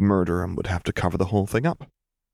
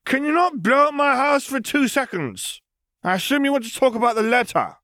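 calling down. A narrator's reading. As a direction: shout down from the roof at someone below. Still calling down from the roof.

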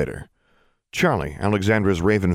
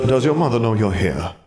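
ghost uncle voice; 5 semitones higher, 4 dB louder than usual. A narrator's reading. Ghost uncle addresses girls.

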